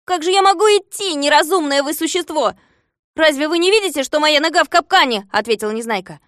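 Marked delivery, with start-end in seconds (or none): 0.1-4.0 high, loud exasperated complaint; loud, high-voiced remonstrance; indignant protest. A narrator's reading. Trapped hero protests to captor. 4.0-5.3 high, loud exasperated plea on «нога в капкане»; aggrieved, pitched-up complaint; indignant protest. A narrator's reading. Trapped hero protests to captor.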